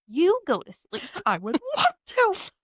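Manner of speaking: high laughing comeback; laughing retort. Retold or imitated. Tired mom laughing back at her toddler. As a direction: laugh through the reply to the toddler. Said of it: "And you're like".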